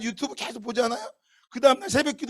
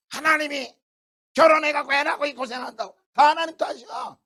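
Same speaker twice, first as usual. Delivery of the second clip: louder, higher blaming rant; grumbling complaint; 4 semitones higher, 3 dB louder than usual. Retold or imitated. Worn-out believer blaming God, griping rant. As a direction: whine that it's all God's fault.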